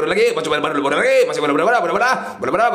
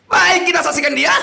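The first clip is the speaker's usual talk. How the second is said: hyped shout; 9 semitones higher, 3 dB louder than usual. Real, not acted. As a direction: shout it out, higher and louder. Announcing the spin.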